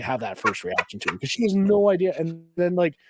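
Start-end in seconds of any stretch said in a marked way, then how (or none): none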